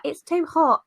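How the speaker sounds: high, whiny typical Brit, lightly put-on British accent; playful British accent complaint; mock British accent. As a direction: moan about the heat in a jokey British accent. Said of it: "Spot on with the British accent there".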